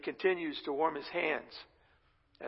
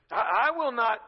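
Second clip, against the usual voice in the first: raised, louder acted insistence; emphatic vow; 5 semitones higher, 6 dB louder than usual. Peter vowing loyalty to his Lord, insistent. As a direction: swear it firmly, as if protesting.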